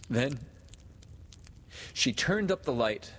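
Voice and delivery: ordinary delivery, nothing marked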